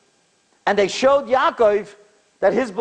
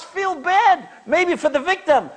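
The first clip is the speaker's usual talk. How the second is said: angry rebuke; 10 semitones higher than usual. Retold or imitated. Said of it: "got so angry".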